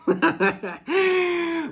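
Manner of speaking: high, bright burst of laughter; fond laugh. Real, not acted. Reader picturing the viewer as a golden retriever, delighted laugh. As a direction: laugh at how cute the idea is.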